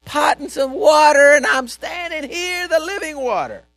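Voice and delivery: loud, fired-up proclamation; excited shout. Acted. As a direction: burst out with excitement. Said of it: "jumping up and down and said".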